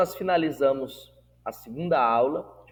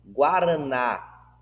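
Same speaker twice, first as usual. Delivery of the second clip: Guaraná slowed, with the final NÁ drawn out; Guaraná with a lengthened, emphasised last syllable; stress on last syllable; much slower than usual. Shown as a demonstration. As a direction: lean long on the NÁ of Guaraná. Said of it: "o acento tônico recai na última sílaba".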